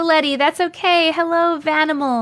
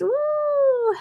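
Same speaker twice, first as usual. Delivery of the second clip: excited woo; 13 semitones higher than usual. Real, not acted. Celebrating a Pisces viewer.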